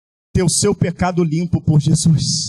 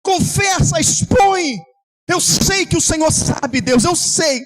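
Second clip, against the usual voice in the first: fervent preaching; 8 semitones higher than usual. Acted. Pastor urging the congregation to pour out their hearts. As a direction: cry out to God for the room. Preaching and praying at full force.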